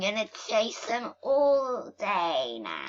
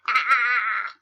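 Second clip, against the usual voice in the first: loud mock evil cackle; hammed-up evil cat laugh; villain laugh; 5 dB louder than usual. Cat villain gloats over mice.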